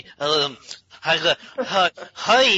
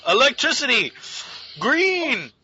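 high comic cry; hammed-up superhero shout; mock exclamation; 7 semitones higher than usual. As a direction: call out each word high, in fake drama. Dubbing a film character's exclamations.

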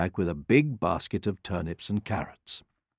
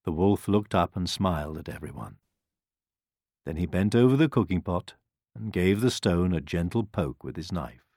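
plain reading voice

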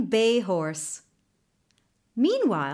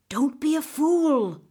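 usual speaking voice

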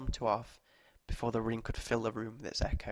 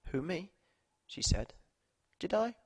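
higher, lightly surprised; mock surprise; 8 semitones higher than usual. Woman to the girls, playfully surprised. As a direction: feign innocence with a lift.

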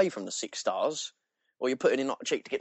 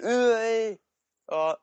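high, louder attempt at a Bosnian accent; badly faked Bosnian, pitched way up; 12 semitones higher, 5 dB louder than usual. Imitating cousin's dad back from Bosnia, failed Bosnian accent. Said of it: "I can't do a Bosnian accent".

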